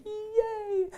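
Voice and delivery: tiny, very high squeal of fake delight; mock cheer. Speaker after Coke spills on the clothes, squeaky mock cheer. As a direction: squeak out a soft, sarcastic cheer.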